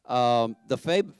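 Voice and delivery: usual speaking voice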